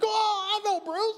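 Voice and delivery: light up hearing the name; surprised recognition. Retold or imitated. Minister recognizing the student's father, surprised and high.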